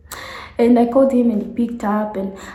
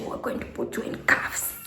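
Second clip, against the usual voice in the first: softer, voiceless threat; whispered threat; 10 dB quieter than usual. Retold or imitated. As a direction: hiss the threat. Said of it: "She's like".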